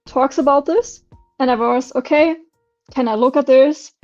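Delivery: ordinary delivery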